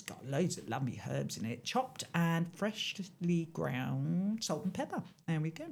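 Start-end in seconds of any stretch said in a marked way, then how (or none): none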